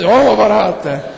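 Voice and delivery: loud mock bellow at normal pitch; man's yell. Preacher shows how a man reacts to cockroaches, loud mock yell. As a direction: holler it like a startled man. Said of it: "o homem já grita".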